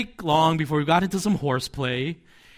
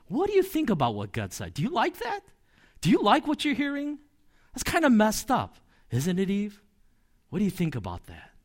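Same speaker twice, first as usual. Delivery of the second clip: tempting serpent; 6 semitones higher than usual. Acted. Voicing the serpent tempting Eve.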